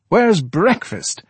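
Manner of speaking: high, slow, put-out demand; peevish. Acted. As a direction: whine it like a spoiled lodger. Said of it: "asked the charwoman peevishly".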